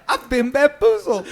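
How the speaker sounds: high, loud, slow laughing words. Real, not acted. Cohost laughing at the story, high and loud. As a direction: crack up while saying it. Laughing fit in podcast banter.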